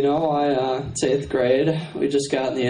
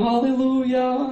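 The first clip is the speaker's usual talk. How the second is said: preacher woman; 7 semitones higher than usual. Retold or imitated. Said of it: "she's like".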